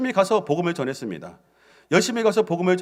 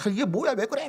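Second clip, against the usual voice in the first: puzzled cannibals; 10 semitones higher than usual. Acted.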